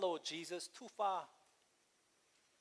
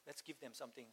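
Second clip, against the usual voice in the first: much softer echo; 4 semitones lower, 17 dB quieter, faster than usual. Retold or imitated. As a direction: drop almost to a hush on the repeat. Repeating Jesus' words twice.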